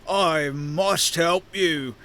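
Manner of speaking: very slow, drawn-out declaration; mind-controlled half-giant. Acted. Half-giant unable to resist the scion's influence, slow entranced voice. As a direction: stretch each word as if compelled. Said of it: "unable to resist it, and the half-giant says".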